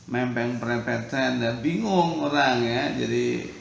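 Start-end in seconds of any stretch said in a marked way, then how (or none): none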